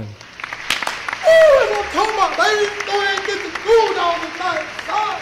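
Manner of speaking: high, loud cheer from the crowd; whooping cheer. Acted. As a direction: holler proudly for your graduate. Parent cheering at graduation.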